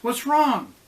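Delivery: high, loud worried question; urgent, rising alarm; a child's anxious cry; anxious plea. A narrator's reading. Worried daughter asks mother.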